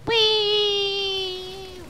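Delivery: long, high "wee"; gleeful whee. Real, not acted. Gamer riding along in the vehicle, long gleeful whee. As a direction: squeal it out like a fun ride.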